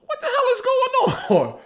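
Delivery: slow hold on, then a high laughing squeal; stunned, half laughing; retold disbelief. Streamer retelling his shock at the likes, stunned and laughing. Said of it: "I'm like".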